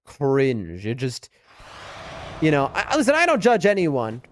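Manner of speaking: ordinary talk, nothing marked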